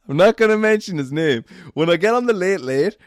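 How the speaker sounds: laughing through a coy aside. Real, not acted. Teasing viewers about a name, laughing. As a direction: giggle out the secret tease.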